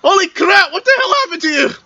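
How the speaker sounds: high, loud shocked cry; shocked outburst. Acted. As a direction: react in horror to the sight. Character sees petrified daughter.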